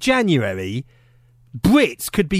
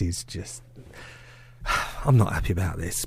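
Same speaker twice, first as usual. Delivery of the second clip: mock heartbroken; 14 semitones lower, 9 dB quieter than usual. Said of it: "I'm getting a bit emotional".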